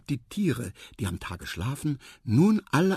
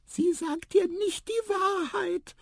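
very high, slow mouse voice; squeaky mouse; slower than usual. A narrator's reading. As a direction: squeak it slowly and firmly. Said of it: "piepste Tiputip". (langsam piepsende Maus)

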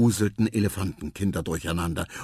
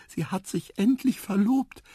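mama's news; 12 semitones higher than usual. Acted. Mama Sada tells news.

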